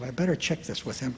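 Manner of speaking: soft quiet inner voice; little inner voice. Retold or imitated. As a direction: let a small voice inside speak. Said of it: "A little voice came".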